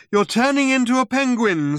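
high-pitched warning to a friend; desperate alarm. A narrator's reading. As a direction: warn him desperately, pitch up. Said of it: "He wound his eyes desperately".

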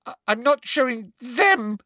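very high, loud shrill refusal; girl refusing her teacher shrilly. Acted. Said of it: "said Hermione shrilly".

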